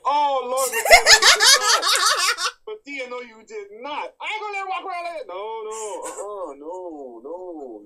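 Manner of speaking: high, loud laughing shriek of shock; laughing outrage at Madea's joke; shocked shout. Pretend. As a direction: gasp out in scandalized disbelief. Shocked onlooker reacting to Madea.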